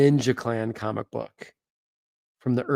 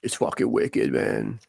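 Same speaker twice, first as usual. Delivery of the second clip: low-pitched imitation of a Boston local; Boston accent; 13 semitones lower, 3 dB quieter than usual. Host imitating friends in Boston admiring comic art, mock Boston accent. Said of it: "as my friends in Boston would say".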